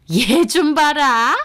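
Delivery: high, slow, drawn-out disbelief; affronted mother-in-law. Acted. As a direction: act appalled at her cheek. (어이없어하는 시어머니 목소리)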